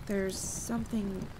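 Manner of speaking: ordinary delivery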